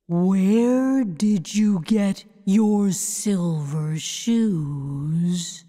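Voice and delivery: slow, low, otherworldly voice; a giant floating head announcing itself slowly; booming Wizard head. A narrator's reading. Giant head greets the visitors, slow and looming. Wizard asks about shoes.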